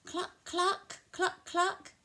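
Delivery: high clucking; hen clucking. Pretend. Animal sounds for children, high hen clucking. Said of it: "make the sounds that the animals make".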